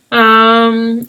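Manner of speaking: ordinary delivery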